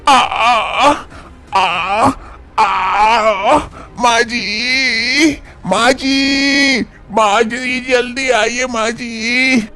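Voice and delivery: high, drawn-out pained cries, then slow pleading calls; crying in pain. Acted. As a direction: moan through labor pains and call for help. Labor pain begins, calling mother-in-law.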